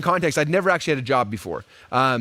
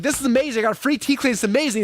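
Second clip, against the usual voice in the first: higher, gushing mock delight; excited; 8 semitones higher than usual. Retelling his delight at the free teeth cleaning, gushing. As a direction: rave about the free cleaning. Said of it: "I'm like".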